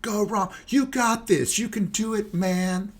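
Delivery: high-pitched, overly upbeat fan cheer; squeaky Captain Positive coach egging him on; mock cheering fan. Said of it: "everybody's yelling at him".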